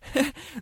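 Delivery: boastful high laugh. Acted. Deer bluffs with a laugh.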